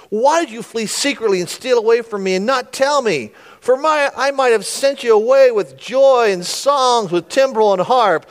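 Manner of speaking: loud, high-pitched overacted protest; laying it on thick. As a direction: plead theatrically, piling it on. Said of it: "Is he just putting it on there thick".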